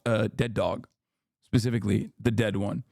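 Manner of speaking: normal talking voice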